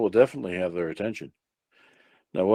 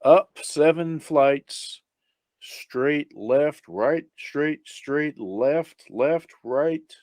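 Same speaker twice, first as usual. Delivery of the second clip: higher, slow, step-by-step recital; reciting directions; 6 semitones higher, much slower than usual. Acted. Blind man gives officer directions to friend, reciting. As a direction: count off each turn separately from memory.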